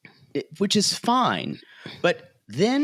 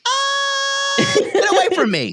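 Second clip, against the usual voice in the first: disgusted shriek; 5 dB louder than usual. Retold or imitated. Recoiling from returning ex.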